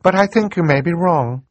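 high-voiced girl speaking up; sudden interjection. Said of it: "Violet said suddenly".

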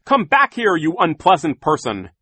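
loud, high, indignant shout; calling out. A narrator's reading. Mister Poe calls villain back through gate, shouting. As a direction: shout after someone beyond a gate. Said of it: "calling through the gate".

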